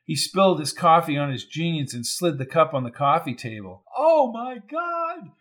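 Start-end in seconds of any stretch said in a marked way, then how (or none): 4.0-5.3 high, slow cry of shock; a stretched, high panicked shout; Sandra cries out in horror as shots ring out. A narrator's reading. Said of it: "shouted Sandra".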